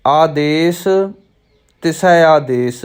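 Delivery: first ਆਦੇਸ alone, a clear break, then ਤਿਸੈ ਆਦੇਸ; pause after ਆਦੇਸ. Shown as a demonstration. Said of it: "ਪਹਿਲੇ ਆਦੇਸ਼ 'ਤੇ ਵਿਸ਼ਰਾਮ ਲੱਗੇਗਾ". (ਪਹਿਲੇ ਆਦੇਸ ਤੇ ਵਿਸ਼ਰਾਮ, ਫਿਰ ਤਿਸੈ ਆਦੇਸ)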